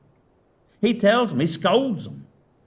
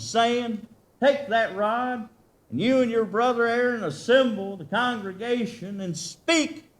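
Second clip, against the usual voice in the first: preaching scripture; 6 semitones higher than usual. Acted. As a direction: proclaim the Lord's words to the flock.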